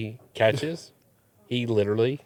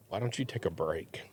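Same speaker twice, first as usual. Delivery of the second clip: quiet; 6 semitones lower, 13 dB quieter than usual. Retold or imitated. Husband sidelining wife.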